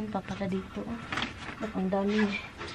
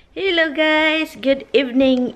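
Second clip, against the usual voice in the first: cheery greeting; 6 semitones higher, 6 dB louder, slower than usual. Real, not acted. Vlog greeting to viewers.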